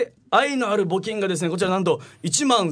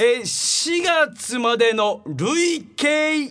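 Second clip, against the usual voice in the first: slow, drawn-out, higher reveal voice; TV appraisal-show announcer; 4 semitones higher, much slower than usual. Acted. Host announces April's donation total, game-show reveal. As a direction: stretch each word for suspense. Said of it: "テレビの方の何でも鑑定団をさ、ちょっと意識してやってたから".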